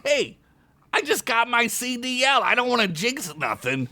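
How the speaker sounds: goblin shout. Acted. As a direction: shout over the beeping. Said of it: "Skunk shouted over the beeping".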